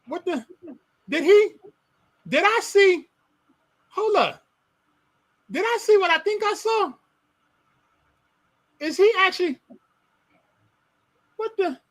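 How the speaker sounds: pause, look again, can't believe it; mock astonishment. Real, not acted. Noticing a cage in the host's room, rising amazed questions.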